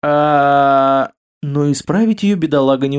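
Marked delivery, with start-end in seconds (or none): none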